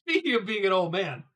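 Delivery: coughing through spit while laughing; choking on saliva mid-sentence. Real, not acted. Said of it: "now I'm choking on my own spit".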